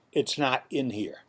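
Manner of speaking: ordinary talk, nothing marked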